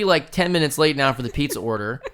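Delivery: ordinary delivery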